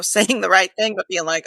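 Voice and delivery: ordinary talk, nothing marked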